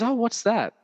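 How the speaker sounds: high, bright mock-neighbour question; curious neighbour. Neighbour finding a wire in the plant pot, puzzled and curious. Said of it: "and she goes".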